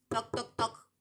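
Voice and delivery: loud mouthed knock-knock; knocking sound. Acted. Number three knocking on the carpenter's door. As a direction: knock with your voice. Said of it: "llamó el número tres".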